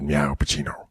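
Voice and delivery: low, soft Pacino growl with a meow; lightly voiced Pacino purr; a hint of Al Pacino as a cat; mock Pacino. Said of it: "when he said".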